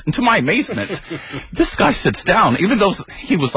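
usual speaking voice

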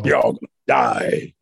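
very deep, growling bear voice; threatening bear. Acted. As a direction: drop right down into a bear's threat.

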